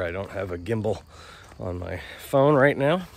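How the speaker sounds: talking while walking, jostled; on a bumpy road. Real, not acted. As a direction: voice jolted by each step. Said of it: "Sorry for the, uh, bumpy ride here".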